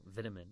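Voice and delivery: Vitamin with a short first i; Vitamin opening like "vit" in "vitality"; British pronunciation. Shown as a demonstration. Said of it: "that's a great example".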